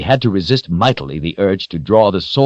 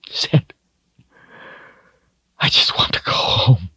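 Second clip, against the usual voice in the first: whispered, broken with tears; tearful whisper; 6 semitones higher than usual. A narrator's reading. Richard clings to Zed, whispering through tears. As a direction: barely get the words out through sobs. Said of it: "He cried in choking sobs".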